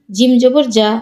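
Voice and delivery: no stretch on যা; short vowel. Shown as a demonstration. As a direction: end on a short যা. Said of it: "কোথায় দ্রুত পড়ি".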